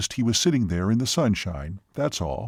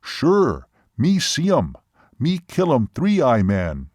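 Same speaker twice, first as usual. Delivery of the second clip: imitated Seminole man; 2 semitones higher, 3 dB louder, slower than usual. Seminole tells waitress of his kill, emphatic. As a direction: declare each short phrase forcefully as the Seminole. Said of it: "even imitating his voice and gestures".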